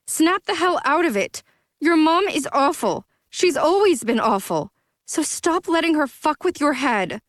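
scolding. Acted. Zoey scolds drunk friend.

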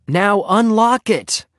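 high, slow urgent plea; beg, stretching out the words; begging. A narrator's reading. Locked-in Henry begs Ramona at the padlock, pleading. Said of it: "he begged".

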